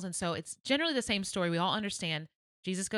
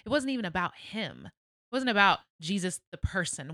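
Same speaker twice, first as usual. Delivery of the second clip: ordinary delivery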